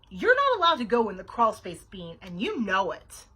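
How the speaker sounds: loud, stern telling-off; scolding. A narrator's reading. Babysitter catching Bean in the crawl space, scolding. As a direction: lay down the rule firmly, slowing at the end.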